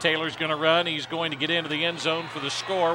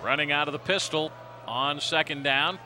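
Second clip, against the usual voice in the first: ordinary delivery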